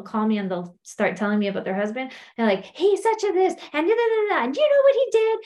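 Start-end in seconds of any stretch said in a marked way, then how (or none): 2.7-5.4 high, fast imitation of a worked-up caller; indignant caller impersonation, pitched way up; mock complaining. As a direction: rattle off a hammed-up gripe. Imitating callers complaining about husbands.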